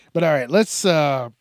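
normal talking voice

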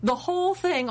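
ordinary delivery

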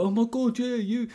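high-pitched mock gushing; imagined excited fan. Imagined fans reacting to her fame.

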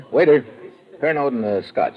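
loud call across the cafe. Acted. Private eye calling the waiter across the room, loud call.

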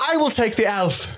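high, grand hero vow; heroic pledge. Retold or imitated. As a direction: swear it like an epic hero.

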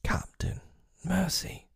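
hushed, high plea for mercy; whimpering. A narrator's reading. Crewman begging his captain for mercy, whimpering. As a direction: whimper it, voice barely there. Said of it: "Starkey whimpered".

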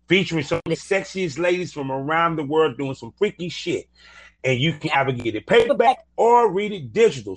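upbeat sponsor plug, punchy on the web addresses; podcast ad read. Acted. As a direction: sell it like a radio promo. Host reads sponsor ads.

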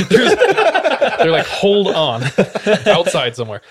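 high, slow words through laughter; laughing while talking. Real, not acted. Retelling the budget ticket joke, laughing hard. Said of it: "laughing through line".

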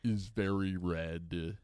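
very slow, drawn-out drifter musing; drifter philosopher. Acted. Comic on stage doing drifter philosophy over background music, slow pompous musing. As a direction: stretch the words like stoned stage philosophy. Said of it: "refer to only as drifter philosophy".

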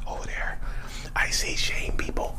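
hushed, breathy whisper pointing out shame. Pretend. To the audience, a mock spooky whisper about shame. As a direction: whisper it like spotting a ghost.

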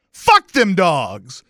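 loud, slow, high reenacted retort; pissed-off retort. Fan dismissing complaint about barking dogs, pissed off. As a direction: punch out each word, fed up. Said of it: "I was so pissed".

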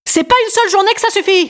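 high, shouted rally slogan; proclaimed slogan. Acted. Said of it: "je l'ai trouvé, mon slogan".

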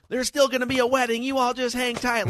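high-pitched mock holler; imitated yell. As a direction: call it out like yelling to a crowd, without full volume. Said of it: "but she yells".

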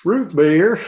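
high, loud squeaky voice; squeaky soda voice. Pretend. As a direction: speak as the talking root beer. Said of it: "And it's talking back".

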